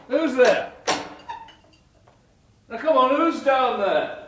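nervous call down to the cellar. Acted. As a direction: call down, trying to sound brave. Calling down into cellar.

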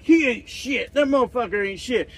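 hammed-up armchair critic; imitating bar guys. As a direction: sneer it like a loudmouth critic. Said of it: "and be like".

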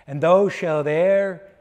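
ordinary delivery

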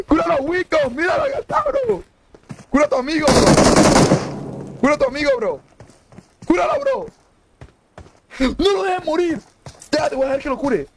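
very high, squealing plea; frantic pleading. Real, not acted. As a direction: beg desperately in a shrieky falsetto. Begging the enemy to heal his friend.

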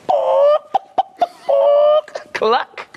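high, loud squawks then a cluck; hen clucking. Acted. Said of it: "A hen says".

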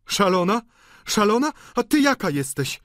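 high, loud outraged woman; indignant retort. A narrator's reading. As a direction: throw the insult back as a question. Sisters quarrel, insult thrown back.

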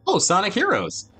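ordinary talk, nothing marked

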